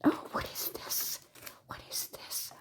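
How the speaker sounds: hushed, voiceless wondering whisper; soft whisper of discovery; awed whisper. Real, not acted. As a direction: whisper it as you peek inside. Opening a surprise parcel.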